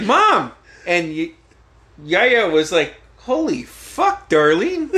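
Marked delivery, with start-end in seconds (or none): 0.0-0.5 high, loud retold cry of protest; mortified protest. Son cutting off mom in front of girlfriend, mortified protest. 3.2-4.9 high, drawn-out mock disbelief; appalled reaction. Dad reacting to mom's oversharing, appalled.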